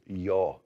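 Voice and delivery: yo with an unrounded O, slow; careful Spanish yo. Shown as a demonstration. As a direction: say yo with an open, unrounded O. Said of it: "Remember not to round that O".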